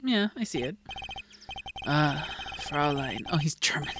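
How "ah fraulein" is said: slow, low charmer's greeting; smooth, flirtatious low voice; suave flirt. Acted. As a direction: savor it like a ladykiller. [name] greets her flirtatiously.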